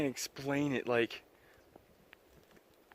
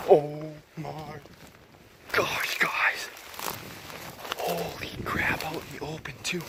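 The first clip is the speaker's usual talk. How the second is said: awed whisper; 4 semitones higher than usual. Real, not acted. Excited find, breathy whisper.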